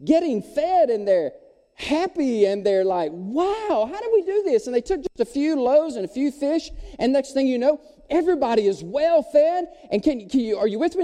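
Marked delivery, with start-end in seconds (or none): 3.1-4.7 high-pitched astonished exclamation; amazed crowd. Acted. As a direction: marvel at it in a high voice. Crowd amazed after being fed.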